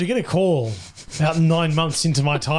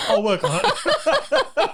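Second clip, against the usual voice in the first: laughing; 11 semitones higher, much slower than usual. Real, not acted. Laughing after his rewind noise.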